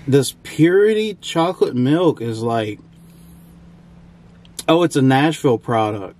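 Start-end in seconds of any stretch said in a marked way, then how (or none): none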